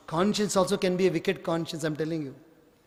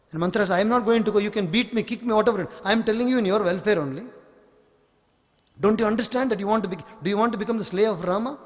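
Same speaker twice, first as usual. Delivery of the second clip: fast, higher, pushy persuading; urgent scheming maid; 3 semitones higher, faster than usual. Acted. Maid pressing the queen to act, fast and urgent. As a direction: rush the words like a schemer pressing her case. Manthara urging Kaikeyi, fast.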